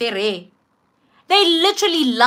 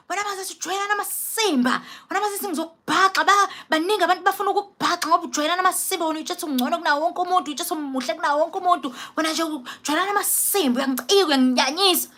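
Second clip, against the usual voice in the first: higher, fast, hostile rant; mock-furious tirade, rattled off; retold friend's hateful outburst, quick and high; spewing hate; 5 semitones higher, 3 dB quieter, faster than usual. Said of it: "she spews just mad hate".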